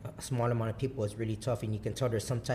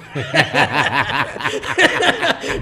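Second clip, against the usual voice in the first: loud laughter; 11 dB louder than usual. Real, not acted. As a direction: crack up loudly. Laughing at wife's reaction.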